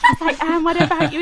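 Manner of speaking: high and loud, laughing; eager, delighted reply; excited. Retold or imitated. Retold classmate answering excitedly.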